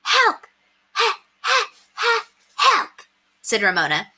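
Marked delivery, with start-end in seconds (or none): 0.0-2.9 put-on high, singsong child voice; high, playful child's call for help; little girl singsong. A narrator's reading. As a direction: sing it out like a small girl pretending. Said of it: "as if she were thinking very hard".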